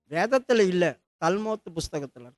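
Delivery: usual speaking voice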